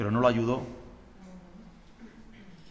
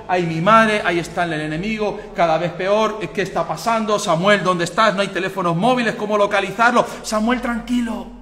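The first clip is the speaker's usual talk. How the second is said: panicked king; 7 semitones higher, 7 dB louder than usual. Acted. Saul panics waiting for Samuel.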